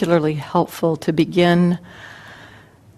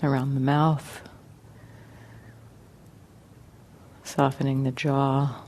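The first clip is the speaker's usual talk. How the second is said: guided meditation; 3 semitones lower, 8 dB quieter than usual. Acted. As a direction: ease them gently into relaxation, unhurried and quiet.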